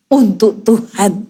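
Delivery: low, slow, deliberate dedication; piously grit through the chore, drawing it out; forced devotion. Acted. Forced devotion while mopping the floor.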